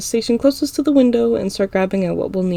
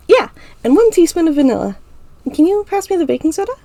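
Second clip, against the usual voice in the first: put-on high girl voice; 9 semitones higher, 5 dB louder than usual. A narrator's reading. Ira voice.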